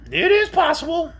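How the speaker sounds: high, shrill creature moan. A narrator's reading. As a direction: moan it as the suffering orc. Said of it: "moaned the orc".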